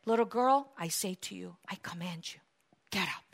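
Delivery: very soft, low authority; quiet, weighty order; hushed command. Acted. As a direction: sink almost to a whisper as you command. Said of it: "he commanded".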